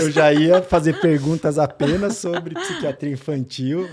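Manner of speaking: amused, voice lifted by real laughter; laughing. Real, not acted. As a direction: laugh your way into the clarification. Host laughing at the mix-up.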